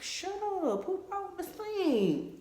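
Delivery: high incredulous "shut up" to a friend; shocked disbelief. Retold or imitated. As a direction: react like you can't believe what he just said. Retelling her shocked reaction again.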